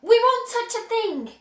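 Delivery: put-on high, loud childlike voice; eager kids swearing obedience; children pleading together; children promising. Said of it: "the children cried".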